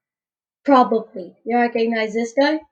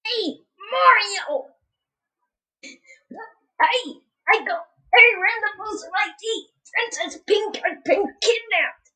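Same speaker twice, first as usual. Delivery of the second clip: high, squeaky, softer character voice; Toad; 8 semitones higher, 5 dB quieter than usual. Acted. Player as Toad calling Mario for help, high squeaky voice. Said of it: "I need a Toad voice".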